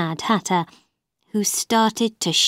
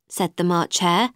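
usual speaking voice

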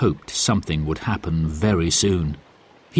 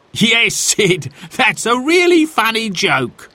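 laughing; 13 semitones higher, 3 dB louder than usual. A narrator's reading.

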